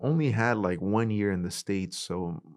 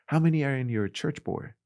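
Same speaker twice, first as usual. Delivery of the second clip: preacher quizzing congregation; 6 semitones higher than usual. Retold or imitated. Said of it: "when I go preaching at churches".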